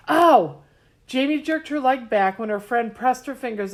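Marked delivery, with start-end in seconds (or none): 0.1-0.6 loud, high sharp yelp; yelp of pain. A narrator's reading. Girl yelping as friend presses her hurt knee. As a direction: cry out at the touch.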